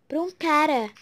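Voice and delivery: ordinary talk, nothing marked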